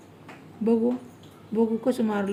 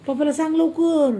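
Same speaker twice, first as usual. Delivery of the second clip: higher, louder hurry-up push; urging; 6 semitones higher, 4 dB louder than usual. Real, not acted. Mother urges toddler to tell papa quickly, raised voice.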